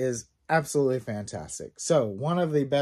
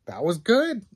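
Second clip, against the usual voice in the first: impressed; 5 semitones higher, 6 dB louder than usual. Retold or imitated. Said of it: "I read it and I was like".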